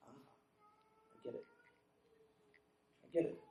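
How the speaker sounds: very soft, barely voiced; quiet, acted dawning understanding; hushed realization. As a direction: let it dawn on you under your breath. Retold sudden sober realization.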